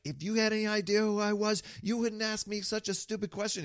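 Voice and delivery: high-pitched indignant yell. Retold or imitated. Coach playing the linebacker yells in his face, high indignant shout. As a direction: snap back as the offended star player. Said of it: "starts yelling".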